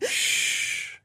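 soft whispered hush; calm her gently; soothing hush. Acted. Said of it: "I soothed".

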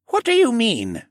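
put-on high questioning older man. A narrator's reading. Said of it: "he questioned".

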